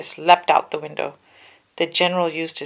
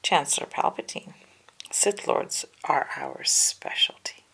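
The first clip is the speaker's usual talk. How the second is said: 1 confident hush; 5 dB quieter than usual. A narrator's reading. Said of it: "But Obi-Wan smiled".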